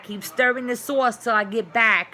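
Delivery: loud call to the kitchen. Real, not acted. Calling out to family in the kitchen, loud. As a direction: shout the reminder across the house.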